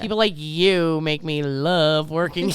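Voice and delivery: "love" drawn out, slow delivery; pointed, drawn-out "love" breaking toward a laugh; stress on LOVE. Acted. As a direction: slowly lean on "you" and "love". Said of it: "it's love is on the italics".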